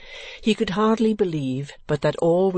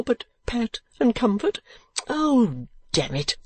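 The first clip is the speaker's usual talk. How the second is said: tender appeal; 5 semitones higher than usual. A narrator's reading. Mantalini begging his angry wife for pity, tender wheedling. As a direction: plead for pity in a high, wheedling voice. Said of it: "by this tender appeal".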